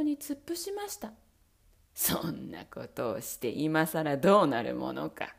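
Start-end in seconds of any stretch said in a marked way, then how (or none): 1.9-5.3 low, whispered sneer; mock her coldly under the breath. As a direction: Bluebeard sneering at his kneeling wife. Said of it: "と青ひげは嘲笑いました".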